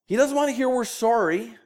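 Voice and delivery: a hint of Minnesota in SORRY; Minnesota accent. Real, not acted. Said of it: "with a funny Minnesota accent".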